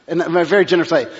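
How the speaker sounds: ordinary talk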